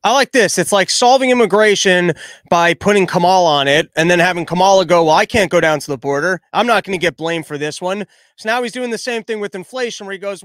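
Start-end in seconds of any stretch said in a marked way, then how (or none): 0.0-8.1 loud, ranting sarcasm at full volume; loud mocking rant. Real, not acted. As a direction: blast the sarcasm out. Mocking rant, much louder.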